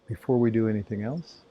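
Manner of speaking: calm, even voice with long silences; spacious, soothing meditation delivery; guided meditation. Acted. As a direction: guide their awareness through the body, leaving space. Leads body-awareness meditation.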